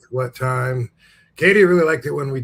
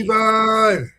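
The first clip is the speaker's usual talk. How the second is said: calling out; 8 semitones higher, 6 dB louder than usual. Acted. Calling out for Devon.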